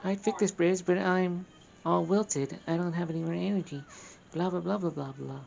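high-pitched put-on voice; high character voice dwindling into blah-blah; weary wilted game character. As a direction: read it high, as if out of energy. Reads game character's dialogue aloud.

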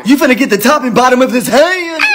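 high-pitched furious scolding; angry dad threat. Acted. Dad threatens to hit son.

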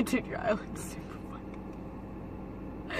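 slow whispered aside, holding back laughter; giggling whisper. Real, not acted. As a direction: whisper it so she can't hear. Giggly aside during makeup.

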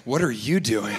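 low, unruffled retort; calm turned-back question. Acted. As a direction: throw it back coolly, pitched low. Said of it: "I just say".

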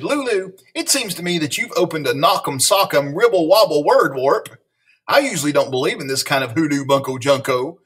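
lively read-aloud with playful bounce on silly words; animated picture-book reading; story narration. A narrator's reading. Reading a silly school story to children, animated storyteller.

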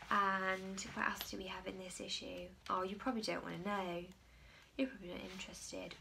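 playful hushed aside; teasing whisper. Pretend. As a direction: whisper it like a tease.

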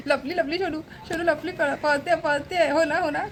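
high, cooing tease; playful pet talk. Real, not acted. Teasing the pet that hid. (लाडीक, खेळकर उंच आवाज)

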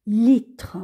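usual speaking voice

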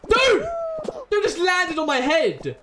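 loud, high outraged yell; high, loud cry of indignation; outraged shout. Real, not acted. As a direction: shout in disbelief at the player. Player lands on his head.

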